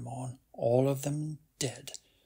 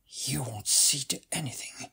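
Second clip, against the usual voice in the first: retort. A narrator's reading. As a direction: retort under your breath to the voice. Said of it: "Raisin retorted".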